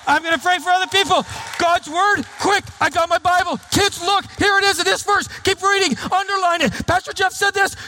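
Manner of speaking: high, eager, rapid; a keen churchgoer on fire for God; engaged worshipper. Pretend. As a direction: race through the service thrilled. Acts an engaged worshipper's morning.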